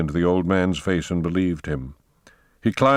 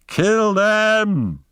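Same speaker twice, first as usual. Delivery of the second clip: very high, loud battle scream; 13 semitones higher, 4 dB louder than usual. Acted. Poe, running, screams the kill order. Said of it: "screamed Poe".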